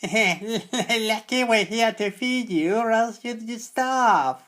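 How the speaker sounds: high, loud, slow cackling screech; hags' screech. A narrator's reading. Old hags gloating at the boy they feed, slow screech. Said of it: "both the old hags would screech".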